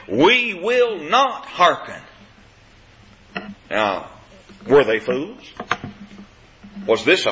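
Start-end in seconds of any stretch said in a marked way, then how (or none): 0.1-2.0 "We will not hearken" slow and higher, each word weighted; emphatic refusal. Acted. The people answering the watchmen, "will not hearken" slow and emphatic. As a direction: refuse slowly, leaning hard on "will not". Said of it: "verse sixteen is emphatic".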